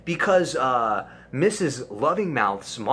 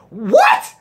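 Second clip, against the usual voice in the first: high, loud disbelieving what; dad blurting out in shock; dad hearing the news on the phone, shocked; 10 dB louder than usual. Retold or imitated. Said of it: "My dad, I can hear him".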